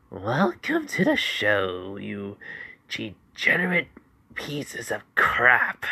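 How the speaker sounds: slow, relishing sinister taunt; menacing villain voice. Acted. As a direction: savor every threat like a sadistic villain. Villain Izuku taunting his captives.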